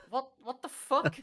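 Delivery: high-pitched, stammered disbelief; utter bafflement. Real, not acted. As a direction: sputter it high, totally lost. Baffled by the pilot's wording.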